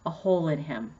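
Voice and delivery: usual speaking voice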